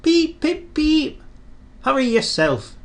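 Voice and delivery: high cheeky engine beeping back; cheeky whistle. A narrator's reading. Thomas answering Gordon back, high and cheeky. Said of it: "said cheeky Thomas".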